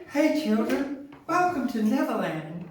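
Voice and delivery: high, breathy-free falsetto greeting. Retold or imitated. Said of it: "I have to do it in a [name] voice".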